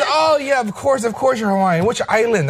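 imitated hairdresser, high-pitched gush; high, effusive acted reply; eager agreement. Imitates hairdresser believing the Hawaiian lie.

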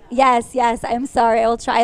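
ordinary talk

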